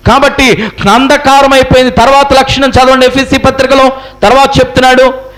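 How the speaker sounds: high, sustained sermon cry; preaching at full force. Real, not acted. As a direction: declaim it to the congregation in long high-pitched phrases. (గట్టి స్వరంతో ప్రసంగం)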